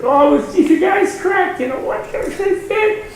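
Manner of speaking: high, comic incredulous dismissal; mock exasperation. Rabbi explains his win to his people, comically dismissive. Rabbi recounts meeting to applauding friends.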